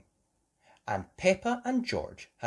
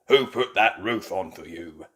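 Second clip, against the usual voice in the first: louder, lower proud builder; booming bull; 3 semitones lower, 5 dB louder than usual. Acted. Mister Bull boasting to Madame Gazelle, loud. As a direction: boast about the roof.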